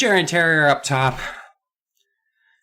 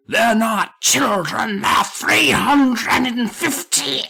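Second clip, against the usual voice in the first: put-on slow, much higher creaky old man; 10 semitones higher, much slower than usual. Old man revealing the kids' age, slow high old voice. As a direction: draw it out like a doddering old man. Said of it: "There he goes again".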